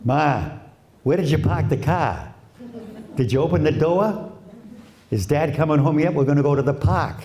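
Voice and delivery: put-on Boston accent, no R's, a kid calling to Mom; lightly played Boston accent, kid's voice. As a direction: drop every R Boston style. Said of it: "did I hear that when I played the recorder back".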